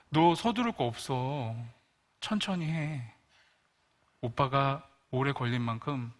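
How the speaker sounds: put-on a kind older brother telling his sister there is no hurry; gentle big brother. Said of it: "좋은 오빠잖아요".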